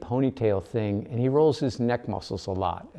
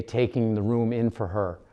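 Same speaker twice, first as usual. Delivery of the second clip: ordinary delivery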